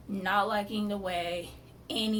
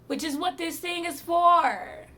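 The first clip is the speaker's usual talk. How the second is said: rising, louder, fed-up explanation; exasperated retort; 7 semitones higher, 9 dB louder than usual. Retold or imitated. To the surgeon about her chemo port, exasperated. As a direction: spell out the obvious, annoyed.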